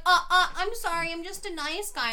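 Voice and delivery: whiny nice guy. Retold or imitated. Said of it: "And he was like".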